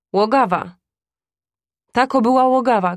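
głośno, wyżej i szybko, z oburzeniem; wzburzony okrzyk. Acted. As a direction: wykrzycz oburzenie w obronie kobyły. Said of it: "krzyknął ojciec". (ojciec krzyczy na kupca z oburzeniem)

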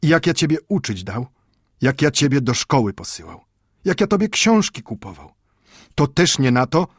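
put-on angry old grandfather. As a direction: scold the boy out of hurt love. Scolding continues.

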